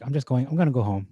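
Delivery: casual paraphrase. Retold or imitated. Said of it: "sounds like".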